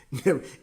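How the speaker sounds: short burst of laughter; real laugh. Real, not acted. Preacher laughing with the congregation, a short real laugh. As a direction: laugh at your own joke.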